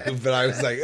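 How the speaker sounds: usual speaking voice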